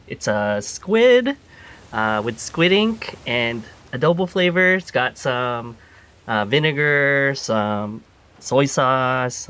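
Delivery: slow, relishing food talk; slow savoring description. Acted. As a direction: savor every ingredient slowly. Chef lovingly describing dish.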